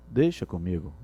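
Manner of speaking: soft and low. Shown as a demonstration. Teacher contrasting a soft, low "deixa comigo". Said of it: "A forma".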